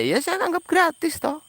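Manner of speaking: high-pitched exasperated retort; defiant retort. Real, not acted. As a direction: throw it back at the commenters.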